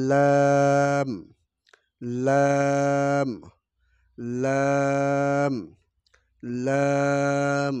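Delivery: লাম drawn out slow, held one count; one-count long laam. Shown as a demonstration. Teacher demonstrating laam length. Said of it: "একালি পরিমাণ লম্বা হবে".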